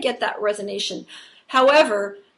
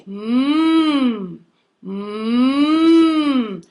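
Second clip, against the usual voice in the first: louder buzzing "mm" held in the mask; big buzzy hum; 4 dB louder than usual. Shown as a demonstration. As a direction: hum so the face vibrates. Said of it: "that big buzzy sound".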